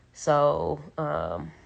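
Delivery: ordinary talk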